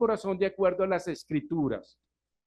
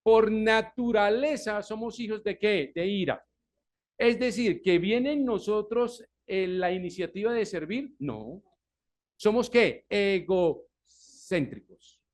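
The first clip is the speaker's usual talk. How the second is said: loud, higher preaching that builds; fervent preaching; 5 semitones higher, 4 dB louder than usual. Acted. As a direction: press the congregation hard. Predicación con fuerza. (predicación enérgica y en voz alta)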